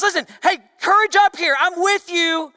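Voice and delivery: Jesus calling to frightened disciples; calling out loud. Acted. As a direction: call out courage over the wind. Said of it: "Jesus speaks louder than anything else".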